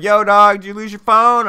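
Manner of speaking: put-on hollering like a drunk fan; drunk bar guys. Drunk bar guy calls to narrator, high loud bro voice. Drunk Browns fans call out.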